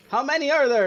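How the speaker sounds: high, loud panicked question; alarmed cry. Acted. Character alarmed at goblin numbers.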